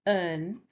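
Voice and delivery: "earn" with a soft r sound. Shown as a demonstration. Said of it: "there is soft r sound".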